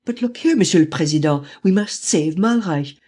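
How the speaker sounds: frantic high-pitched appeal for quick action; urgent pleading. A narrator's reading. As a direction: press the Premier desperately. Lupin urgently pleading to Premier.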